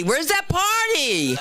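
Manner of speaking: high, slow, drawn-out teasing question. Shown as a demonstration. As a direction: stretch out "Where's that party?" slowly to needle the crowd.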